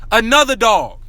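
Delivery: ordinary talk, nothing marked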